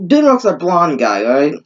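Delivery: normal talking voice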